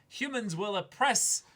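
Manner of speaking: rising to a passionate peak; excited emphasis. Real, not acted. Podcaster pressing the point to cohost, excited and slow. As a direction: pitch jumps way up, slowing down on the words.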